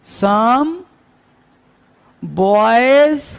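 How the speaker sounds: put-on each word spaced out slowly, some then boys; teacher's slow model: some, then boys; slow and clear. Shown as a demonstration. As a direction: carefully spell out some boys for a learner. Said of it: "और साफ देखो".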